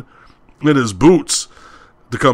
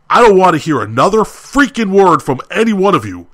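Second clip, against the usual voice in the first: louder, higher re-enacted threat, hammering it out; angry ultimatum; 5 semitones higher, 4 dB louder than usual. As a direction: act out warning the whole staff angrily. Retold furious threat to whole staff.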